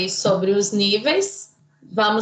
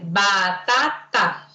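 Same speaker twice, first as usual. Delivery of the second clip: put-on slow, low child reading Batata, syllable by syllable; 4 semitones lower, much slower than usual. Shown as a demonstration. Teacher imitating a child slowly reading Batata. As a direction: sound out Batata like a beginner reader. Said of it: "Aí ela vai ler pra você".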